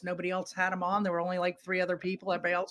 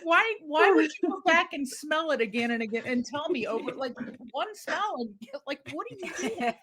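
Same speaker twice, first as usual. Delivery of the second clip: higher-pitched, baffled retold complaint; mock exasperation; 6 semitones higher than usual. Wife to husband who keeps sniffing milk, exasperated.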